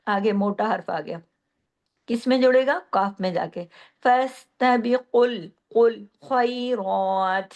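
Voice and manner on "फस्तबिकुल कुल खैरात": slow recitation, light फस, full-mouthed कुल खैरात; thin then heavy letters. Shown as a demonstration. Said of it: "इसमें मोटे हरूफ कौन कौन से". (फस्तबिकुल खैरात, मोटे हरूफ भारी करके)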